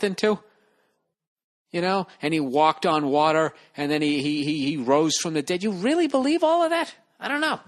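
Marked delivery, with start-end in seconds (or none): 5.6-7.6 much higher, squeaky incredulous question; voice going way up. Real, not acted. Comic asking believers, voice shooting up high. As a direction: let it climb up into a squeak. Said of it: "Why is my voice going up so high".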